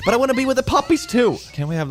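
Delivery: whiny. Acted. Whiny plea for puppies.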